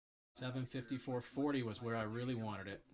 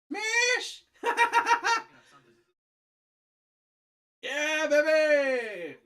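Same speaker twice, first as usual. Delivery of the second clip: excited cheer; 13 semitones higher, 11 dB louder, much slower than usual. Real, not acted. Cheering a market drop.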